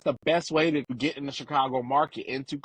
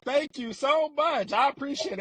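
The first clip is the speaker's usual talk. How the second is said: gushing thank-you; 11 semitones higher than usual. Retold or imitated. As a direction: coo it out like a touched fan. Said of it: "I'll just be like".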